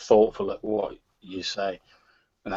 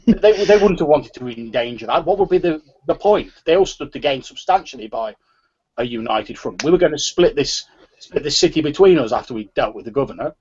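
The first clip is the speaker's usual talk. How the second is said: stumbling, insistent protest, raised voice; agitated gang member; 4 semitones higher, 6 dB louder than usual. Acted. Gang member protests to a rival that nobody would do this, agitated. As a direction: argue it hotly, tripping over the words. Game master voices indignant character.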